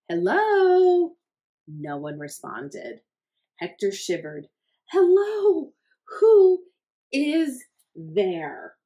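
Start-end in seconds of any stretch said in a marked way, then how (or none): 0.1-1.2 high, loud frightened hello; scared call. Acted. Hector calling into the silent phone, high and scared. 4.9-8.8 high, loud terrified cry; terrified call. Acted. Hector yelling into the silent phone, high and terrified. Rising fear.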